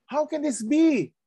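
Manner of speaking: high puzzled question in character; high, bewildered voice of a questioning Pharisee; voicing Nicodemus. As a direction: ask it as the baffled Nicodemus.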